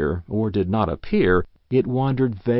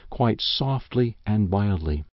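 plain reading voice

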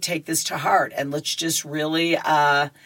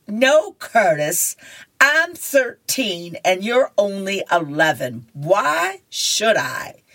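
slow, higher-pitched put-down; voiced older girl snubbing a boy; haughty comic-strip girl; 4 semitones higher, slower than usual. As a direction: turn him down with slow, drawn-out emphasis.

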